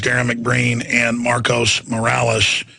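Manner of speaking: ordinary talk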